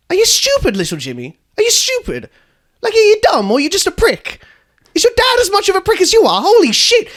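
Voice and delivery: high, outraged berating; angry rant. Acted. Bus guide berating little Jimmy in outrage. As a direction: tear into the kid, pitch shooting up.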